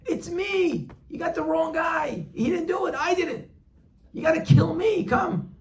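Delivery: frantic high shout, pleading to be killed instead; screaming. Acted. Friend yells to the hangman to take him, frantic high shout. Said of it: "He hears this guy scream".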